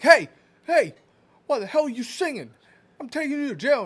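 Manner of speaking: high, loud mock cop yell. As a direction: bark it like an annoyed cop, hammed up. Cop yelling in retold video.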